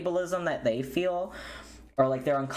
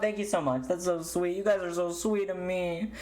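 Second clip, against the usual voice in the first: sweet thanks; 7 semitones higher than usual. Real, not acted. Thanking subscribers.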